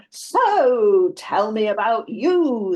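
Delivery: loud, drawn-out mock-keen prompt; date's opening line. Date returning from the gents, over-eager and slow. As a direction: stretch it out like a rehearsed chat-up routine. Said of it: "he's back for the".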